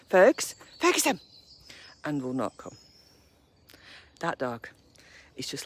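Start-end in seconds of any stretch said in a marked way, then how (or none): 0.1-1.2 loud, high call across the field; calling the dog. Real, not acted. Calling dog who won't come.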